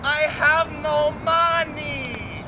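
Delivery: high, slow silly voice. Acted. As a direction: whine it slowly.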